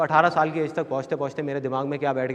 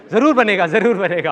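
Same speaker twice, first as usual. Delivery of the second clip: loud, raised cheer of encouragement; retold enthusiasm; 3 semitones higher, 6 dB louder than usual. Mother encouraging her son's big dream, emphatic. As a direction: imitate a mum cheering her boy on.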